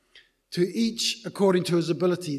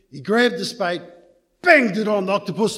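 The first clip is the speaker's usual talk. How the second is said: usual speaking voice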